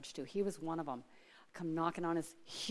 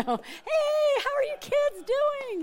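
much higher, louder, slow booming hello; hearty neighbor greeting; 8 dB louder, much slower than usual. Retold or imitated. The rich builder calling out to her kids, loud high greeting. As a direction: greet the kids like a jovial big-shot neighbor.

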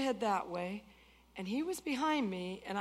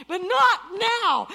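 shouted refusal; 9 semitones higher, 11 dB louder, slower than usual. Retold or imitated.

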